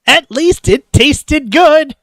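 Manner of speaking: high, loud, drawn-out exclamation; emphatic exclamation. Acted. Diner judging a possibly poisoned meal, emphatic exclamation. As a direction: belt it out slowly and high. Exclaims over food in acted reading.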